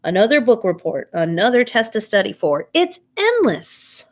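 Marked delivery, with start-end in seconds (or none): 2.7-4.1 exasperated. A narrator's reading. Kid groaning about endless homework.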